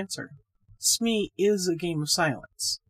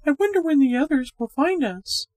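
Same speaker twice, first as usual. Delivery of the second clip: higher, brighter-pitched woman, chatty and a little uneasy; Miss Gorman's voice; 9 semitones higher, 6 dB louder than usual. A narrator's reading. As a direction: play the friendly young woman, higher and louder.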